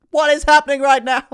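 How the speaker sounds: very high, very loud yell; over-the-top screamed disbelief; hammed-up scream. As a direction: suddenly shriek the question mid-sentence. Sudden outburst over falling price.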